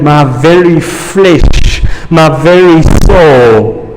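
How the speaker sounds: slow, weighted "my very flesh, my very soul"; preacher slowing down for weight; slow emphasis. Acted. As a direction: stress "very" in both phrases. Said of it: "In other words".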